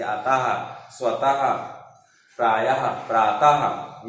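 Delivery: अतः, स्वतः ending in an audible "ha"; word by word, प्रायः and प्रातः closed on a breath of "ha"; visarga as "ha". Shown as a demonstration. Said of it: "इसका उच्चारण हा के समान होता है".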